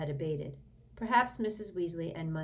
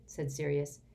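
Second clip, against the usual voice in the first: ordinary delivery, nothing marked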